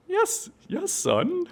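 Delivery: high, patient puppet mother voice; mother polar bear. Acted. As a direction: reassure the cub in a high puppet voice. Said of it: "And the mom goes".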